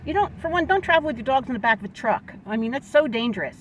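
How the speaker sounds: loud, high-pitched heated rant; worked-up scolding. Real, not acted. Telling truck owners not to carry dogs in back, worked up. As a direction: scold hotly, really agitated. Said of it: "I'll calm down now".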